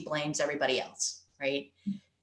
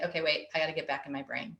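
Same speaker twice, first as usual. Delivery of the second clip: quick self-talk; 4 semitones lower, 6 dB quieter, faster than usual. Retold or imitated. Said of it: "you go and you say".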